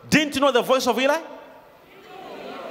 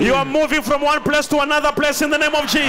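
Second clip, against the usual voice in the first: louder, higher preaching declaration; full-force preaching; 5 semitones higher, 5 dB louder than usual. Acted. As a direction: proclaim it over the congregation.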